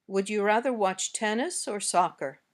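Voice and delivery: up on "tennis", down on "soccer"; choice-question intonation. Shown as a demonstration. Teacher gives learners a choice question, rise then fall. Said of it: "Now say these sentences".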